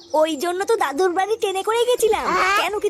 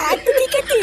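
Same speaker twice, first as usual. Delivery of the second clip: giggling; 5 semitones higher, 3 dB louder than usual. Acted. Child giggles, agreeing to come.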